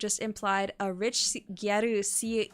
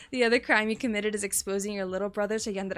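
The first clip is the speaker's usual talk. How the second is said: ordinary delivery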